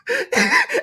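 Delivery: high squealing laugh; burst of high laughter. Real, not acted. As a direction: crack up at the joke. Laughing at own joke.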